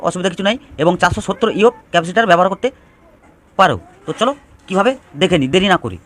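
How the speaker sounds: ordinary talk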